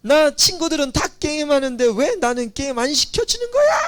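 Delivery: put-on yelling teen. Child yelling at parent about games, shouting. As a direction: complain loudly at a parent. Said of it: "뭐 이렇게 소리 지르게 하고".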